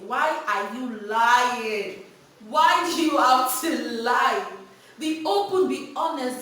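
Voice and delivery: loud, slow, drawn-out reproach; emphatic scolding. Real, not acted. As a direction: press the point hard on parents. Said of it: "scolding parents".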